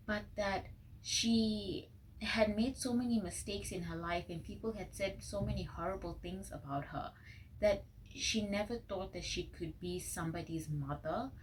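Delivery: usual speaking voice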